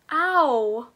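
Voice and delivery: sharp pained yelp; sudden ouch. Real, not acted. Reviewer poked by the packaging, yelping. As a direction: yelp as something pricks you. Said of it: "it came out and poked my finger".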